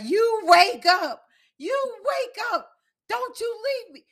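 very high, drawn-out mock wail; imitated screaming plea. Louis begging dying Kane to wake, imitated screaming plea. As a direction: wail it slowly, imitating her desperation. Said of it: "now she's screaming".

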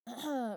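loud, pointed throat clearing. A narrator's reading. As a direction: clear your throat to get attention. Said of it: "Eli cleared his throat".

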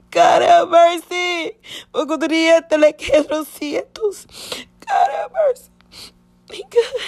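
pleading through tears, pitch cracking upward; real crying. Real, not acted. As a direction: voice climbing into sobs, high and breaking. Breaking down recalling mother's death.

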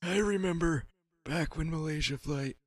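put-on high, creaky old man voice. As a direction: play a doddering old man remembering. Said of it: "pretty soon you'll be hearing my voice".

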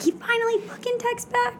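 very high, soft squeal; comic high-pitched girlish freak-out; acted freak-out. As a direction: squeal it in hushed mock excitement. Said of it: "basically how girls freak out".

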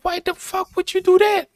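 angry retort. Retold or imitated. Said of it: "And then I got angry at her".

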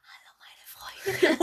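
slow, unvoiced ASMR whisper. Boy playing an ASMR host greeting friends, whispering. Imitates ASMR, flüsternd reden.

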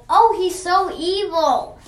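high, loud exclamation; loud, dramatic mock dismay; alarmed crowd. Crowd reacts to evil alien.

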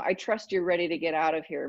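ordinary talk, nothing marked

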